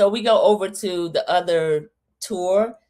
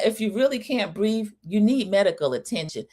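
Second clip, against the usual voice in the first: normal talking voice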